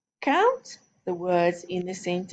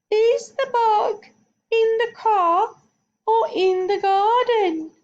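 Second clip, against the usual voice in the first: very high, tiny squeak of a voice; squeaky voice; 5 dB louder than usual. Shown as a demonstration. Teacher demos a silly voice for the class, very high squeak. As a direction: squeak it out like a little mouse. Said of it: "Let's use a squeaky voice".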